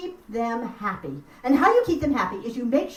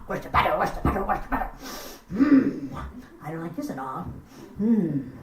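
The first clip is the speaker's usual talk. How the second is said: lightly voiced grumbling little elf, lower; a hint of a cross little gnome; mild grumble of a tricked elf; grumpy Nissen; 5 semitones lower than usual. Nissen complains about missing butter.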